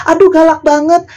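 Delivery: high, put-on whiny voice; mocking complainer. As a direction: sigh it like a picky viewer. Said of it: "Terus nanti kalau ngomongnya agak keras". (meniru penonton yang mengeluh, suara dibuat-buat)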